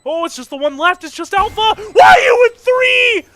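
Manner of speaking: excited shout. Real, not acted. Caster screams a clutch play.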